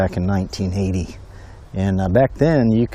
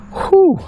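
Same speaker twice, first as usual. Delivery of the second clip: sharp, high exhale of relief; high relieved whew; 3 dB louder than usual. Real, not acted. Angler catching his breath after a fight. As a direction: blow out the tension.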